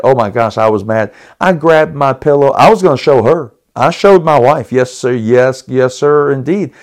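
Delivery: usual speaking voice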